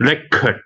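"record" said REC-ord, falling away after "rek"; stress on first syllable. Shown as a demonstration. Presenter explains the noun, first-syllable stress. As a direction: hit the front of "record". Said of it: "ถ้าเราเน้นคำหน้า".

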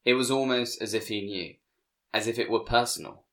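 usual speaking voice

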